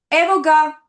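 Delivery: calling out. Shown as a demonstration. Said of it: "passing the phone".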